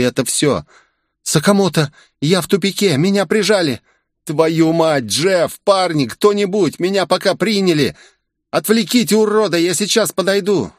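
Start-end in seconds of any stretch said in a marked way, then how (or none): 1.2-3.8 put-on higher girl's voice, pleading for backup; panicked call for help. Asami calls Sakamoto for help, panicked. 4.2-10.7 much higher, urgent voice rallying the others; urgent call to team. Acted. Sakamoto rallies the team, urgent.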